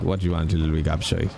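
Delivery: rapid-fire Irish accent, cheeky insults; fast Irish accent. Shown as a demonstration. Streamer demos his Irish character for chat, fast Irish accent. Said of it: "if I play an Irish character, I'm gonna speak like this. I'm gonna speak very fast".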